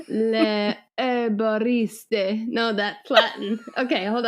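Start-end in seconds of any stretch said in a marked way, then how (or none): 0.1-2.5 put-on French accent. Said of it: "do it in French".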